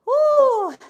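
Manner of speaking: high, loud whoop of triumph; a loud cheer to celebrate the last rep. Real, not acted. Coach cheers after the set.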